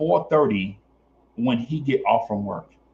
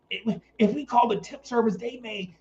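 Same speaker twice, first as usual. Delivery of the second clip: threatening boss; 10 semitones higher, 4 dB quieter than usual. Retold or imitated. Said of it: "So he like".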